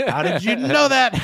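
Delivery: high, loud, slow mock outcry; laughing imitated quote. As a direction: quote it while cracking up. Quoting a favorite funny scene.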